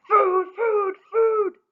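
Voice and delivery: loud, high greedy chant; bellowing demand. Acted. Sisters demanding supper from Rella, loud greedy bellow. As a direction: bellow for food. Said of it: "rubbed their bellies and bellowed".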